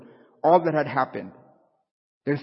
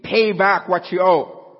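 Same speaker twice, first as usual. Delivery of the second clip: harsh demand; 4 semitones higher, 7 dB louder, slower than usual. Acted. Slave choking his fellow slave.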